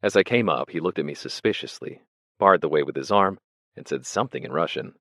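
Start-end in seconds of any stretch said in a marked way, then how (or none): none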